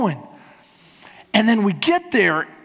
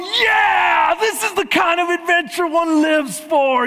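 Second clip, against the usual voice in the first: excited shout; 8 semitones higher, slower than usual. Retold or imitated. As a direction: whoop it up like the trip of a lifetime. Cheering on scared wife.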